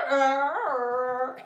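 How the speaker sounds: high, slow, imitating a violin; mock violin singing. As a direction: sing the notes like a violin.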